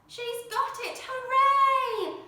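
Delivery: high, loud hooray; excited cheer. Acted. Crowd cheering the ballerina's leap, excited. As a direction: cheer her on with joy.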